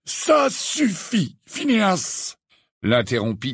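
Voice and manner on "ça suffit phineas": high, very slow, drawn-out rebuke; firm old headmaster. A narrator's reading. Dumbledore interrupting the portrait, firm rebuke. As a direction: cut him off with slow, high authority.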